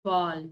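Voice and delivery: "ball" ending in a tongue glide from mid-palate; gliding tongue. Shown as a demonstration. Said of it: "gliding your tongue from the middle".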